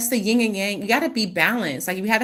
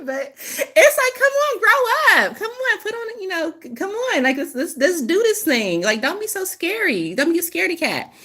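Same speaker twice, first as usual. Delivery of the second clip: teasing spirit voice; 10 semitones higher than usual. Retold or imitated. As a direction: chide them like a playful elder. Channeled voice scolding the listener.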